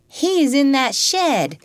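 put-on high, slow child's voice; little boy voice. A narrator's reading. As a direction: answer shyly like a small boy. Little boy answers George.

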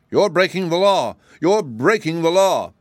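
high, squeaky cry of alarm from a small man; squeaky gardener. Acted. Gardener peeping from a bush at the stranger, squeaky alarmed cry. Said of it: "cried in a squeaky voice".

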